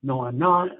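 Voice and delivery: a therapist caught out by a client, defensively loud. Retold or imitated. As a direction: get defensive and push back hard. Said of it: "I get defensive and say".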